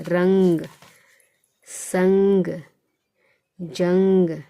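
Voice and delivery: रंग, संग, जंग each loud, with a held nasal ं; nasal anusvara. Shown as a demonstration. Teacher giving anusvara examples to pupils, रंग, संग, जंग loud and nasal. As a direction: ring out the ं of रंग, संग, जंग through the nose. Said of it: "नौ से इसकी ध्वनि आ रही है".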